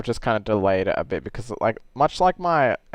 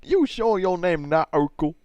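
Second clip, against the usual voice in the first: higher-pitched put-on cartoon voice; put-on character voice; 7 semitones higher than usual. Teasing a cartoon cop about his name, higher character voice. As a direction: ask it in a raised, put-on voice. Acted bit with the police character.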